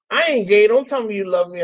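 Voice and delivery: high, loud mock indignation; joking protest. Brother joking away an I love you, high mock protest. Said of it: "he tried to soften it up".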